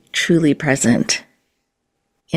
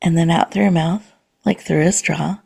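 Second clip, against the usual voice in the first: guided meditation. Acted. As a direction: gently guide the listener into calm and presence.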